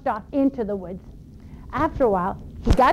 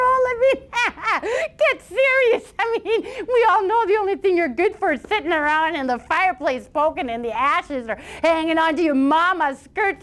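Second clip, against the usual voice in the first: much higher, scornful teasing with a laugh; mocking brothers; 7 semitones higher than usual. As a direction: sneer at the little brother. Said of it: "Other brothers teased him".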